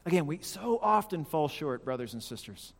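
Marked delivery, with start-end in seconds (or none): none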